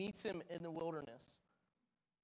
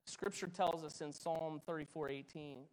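usual speaking voice

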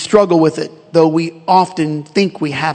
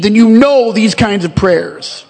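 ordinary talk

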